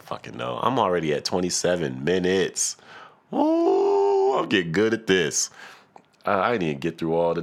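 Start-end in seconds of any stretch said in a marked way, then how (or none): 3.3-4.3 high delighted ooh. Real, not acted. Pleased with himself.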